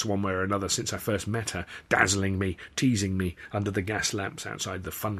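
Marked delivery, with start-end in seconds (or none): none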